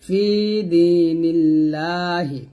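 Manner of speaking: দিনিল্লাহি recited slowly, the lam light and thin; slow tajweed recitation of দিনিল্লাহি with a thin lam. Shown as a demonstration. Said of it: "পাতলা হবে লামটা".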